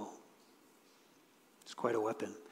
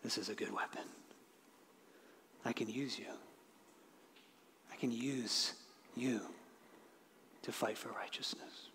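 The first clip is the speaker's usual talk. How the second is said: much softer, fading to a whisper; tender reassurance; 5 semitones lower, 19 dB quieter than usual. Acted. Jesus telling doubting believers he can use them, softly tender. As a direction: speak it like a king comforting a frightened child.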